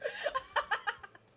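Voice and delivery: loud high cackle; laugh. Real, not acted. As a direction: crack up at your own mistake. Laughs at own blunder.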